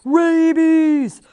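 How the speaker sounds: loud, high, stretched-out wail; panicked cry. A narrator's reading. Fezzik cries out about the king bats, panicked wail. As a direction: wail each word in panic. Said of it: "before Fezzik cried".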